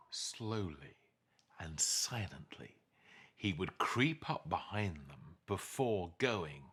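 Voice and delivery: soft, creeping storyteller whisper; hushed narration of a sneaky cub; hushed sneaking. A narrator's reading. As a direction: draw it out quietly like tiptoeing. Sneaking up, told slowly.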